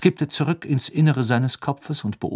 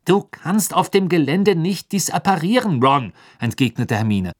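high, loud correction; know-it-all Hermine; 6 semitones higher, 4 dB louder than usual. A narrator's reading. Hermine corrects Ron, high and loud. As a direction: set him right briskly.

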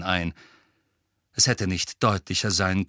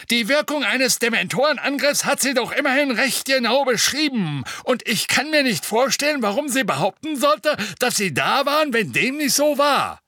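loud, high booming official; booming woman's voice; 14 semitones higher, 4 dB louder than usual. Acted. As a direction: boom out, drawn and pitched higher. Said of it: "mit ihrer dröhnenden Stimme". (dröhnende Stimme, laut und höher)

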